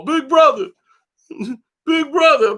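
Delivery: a hammed-up high whimpering plea; mocking crybaby. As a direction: whine like a kid running to his brother. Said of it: "he run to Jamal".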